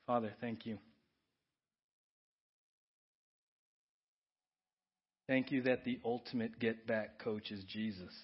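soft, earnest prayer voice over music; pastoral prayer. Acted. Pastor praying over church before communion, earnest and soft. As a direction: pray quietly and sincerely for the congregation.

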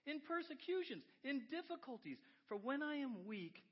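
high, loud scripture proclamation; full-force preacher's reading; impassioned preaching. Acted. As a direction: belt the verse out from the pulpit.

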